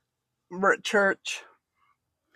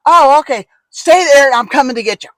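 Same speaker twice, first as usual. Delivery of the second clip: higher and louder, hurried alarm; a worried friend rushing to help; frantic friend; 6 semitones higher, 9 dB louder than usual. Retold or imitated. Janella on the phone, alarmed, promising to come.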